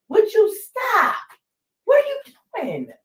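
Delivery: high-pitched scold at the dog, slowed; exasperated high scolding of a pet. Real, not acted. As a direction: squeal at the dog to stop.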